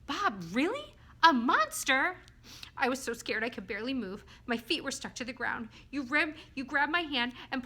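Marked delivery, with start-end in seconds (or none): 0.1-2.2 high, loud incredulous questions; astonished disbelief. Acted. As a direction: echo it back in amazement, pitched up. Astonished reply to Bob's revelation.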